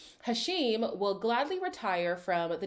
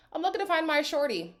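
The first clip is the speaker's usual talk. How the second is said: higher-pitched mocking read-back; mock quoting; 7 semitones higher, faster than usual. Repeating a contestant's strategy to viewers, lightly mocking. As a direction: lift the pitch, lightly making fun of him.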